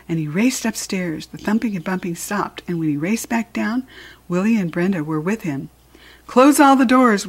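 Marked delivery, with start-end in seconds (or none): 6.3-7.3 louder, higher fatherly command; raised, insistent voice; firm order. A narrator's reading. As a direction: give a firm order to the child. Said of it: "his father repeated firmly".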